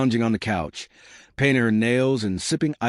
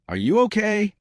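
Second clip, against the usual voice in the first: worried; 12 semitones higher than usual. A narrator's reading. Parent rushing to slapped child.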